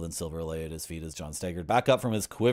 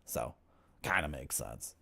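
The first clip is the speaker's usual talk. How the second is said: usual speaking voice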